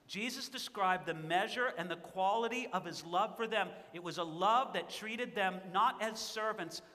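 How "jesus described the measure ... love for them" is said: ordinary delivery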